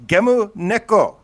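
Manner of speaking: "Gemu Neko" said slowly in Japanese, GEH-moo NEH-ko; "Gemu Neko" with Japanese vowels, each syllable even; Japanese pronunciation. Shown as a demonstration. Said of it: "I learned how to say game cats in, uh, Japanese".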